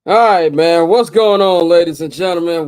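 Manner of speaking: loud, pumped-up show opener; loud hype greeting. Real, not acted. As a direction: call out to the crowd. Host loudly opens the show.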